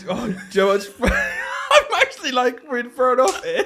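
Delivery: high, loud, slow laughing. Real, not acted. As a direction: laugh through shock. Laughing, shocked.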